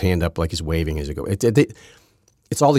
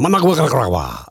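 Managua, Nicaragua in an exaggerated Spanish accent, higher; mock Spanish accent; 7 semitones higher, 4 dB louder than usual. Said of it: "all of a sudden they go".

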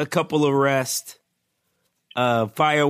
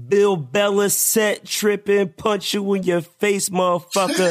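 raised, declaimed punchline delivery; announcer-style roll call; 7 semitones higher than usual. Acted. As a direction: proclaim the punning alias like a ring announcer. Performs punning nickname roll call.

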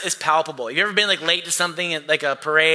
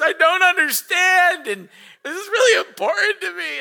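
hammed-up little kid meltdown; mock hysterical child; 6 dB louder than usual. Daughter pleading with parents about bunny, mock tearful wail. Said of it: "she starts hysterically crying".

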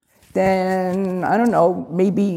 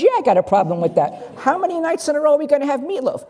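higher, fast, fed-up wife; mock exasperated complaint; 7 semitones higher, faster than usual. Wife complaining about meatloaf again, high and fast, exasperated. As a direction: fire it back at him, exasperated. Acted meatloaf quarrel, wife's line.

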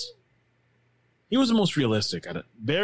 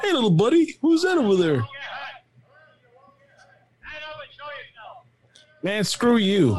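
high, louder parody of a mismatched dub; mock dubbed voice; 12 semitones higher, 4 dB louder than usual. Gamer mocking the game's mismatched dub, high and loud. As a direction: ham up the voice that doesn't fit the body. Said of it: "That voice does not match that body".

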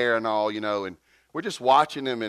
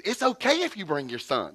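higher-pitched, exaggerated reassurance; exaggerated welcome; 6 semitones higher than usual. Preacher welcoming a father through a translator, exaggerated welcome. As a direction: imitate your own over-eager welcome. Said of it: "so I'm saying like this".

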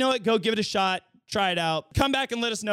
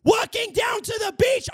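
outraged shout; 12 semitones higher, 4 dB louder than usual. Real, not acted. Outrage at skipped wedding.

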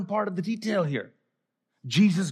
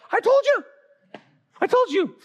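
high-pitched panicked I-told-you-so; mock the panicking disciples in a high cry; freaking out. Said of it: "they're freaking out".

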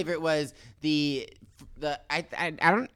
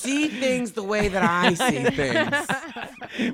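slow, high whiny plea; mock pleading; 9 semitones higher, much slower than usual. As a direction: beg dramatically, drawn out.

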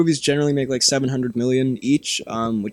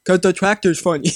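impression of friend; 4 semitones higher than usual. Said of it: "That's my impression of you".

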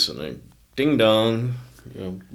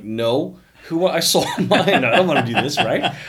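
higher, louder, laughing refusal; eight-year-old refusing his mom, loud and laughing; kid's protest; 8 semitones higher, 10 dB louder than usual. Retold or imitated.

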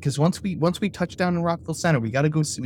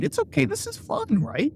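forced cheer; 13 semitones higher than usual. Pretend. Forced reassurance to nervous kids.

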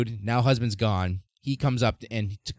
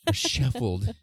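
raised-pitch, put-on English voice; hammed-up English place name; mock English accent; 4 semitones higher, much slower than usual. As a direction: say it like an overdone Englishman. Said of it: "so fucking English".

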